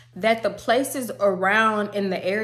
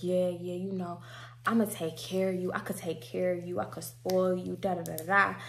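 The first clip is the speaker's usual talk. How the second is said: smooth talk; 2 semitones lower, 8 dB quieter than usual. Retold or imitated. Juvie sweet-talking her in car.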